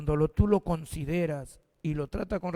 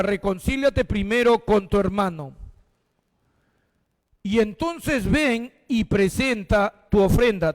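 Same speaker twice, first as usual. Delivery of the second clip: higher, fervent reading voice; preaching scripture; 3 semitones higher, 4 dB louder than usual. Acted. Pastor reading Matthew to the congregation, fervent and raised. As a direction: proclaim each line of the verse.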